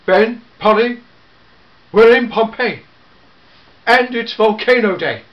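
loud, alarmed, hurrying them off; urgent call. Acted. The Doctor urging Ben and Polly to flee, loud and urgent. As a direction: raise the alarm and rush them back.

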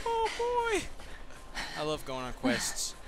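usual speaking voice

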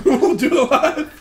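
laughing. Retold or imitated. Repeats quote laughing.

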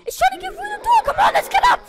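very high, loud shouts of terror; urgent, shrieked alarm as it breaks in; panicked screaming. Real, not acted. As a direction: scream a frantic warning. Monster breaking through door.

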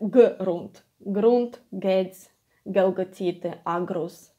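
g in grunt, gates, agrus hit as a firm stop; strong hard g. Shown as a demonstration. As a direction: pronounce every g strongly, as guh. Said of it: "to pronounce it strongly".

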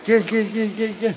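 high, loud sing-song kitty call; calling a kitten. Real, not acted. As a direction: coax the kitten over, high and sweet.

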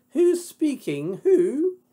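high falsetto inquiry. Acted. Heroine asks who is phoning, high-voiced. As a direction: ask who's calling in a high girlish voice.

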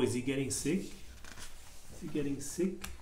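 ordinary delivery, nothing marked

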